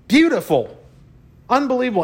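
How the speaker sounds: high, rising wonder; awed exclaim. Real, not acted. Speaker to audience, high awed exclamation.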